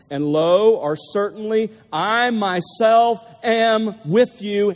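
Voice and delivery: "I myself" stretched out slowly; slow, weighty stress on "I myself"; emphatic reading. Shown as a demonstration. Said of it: "included for emphasis".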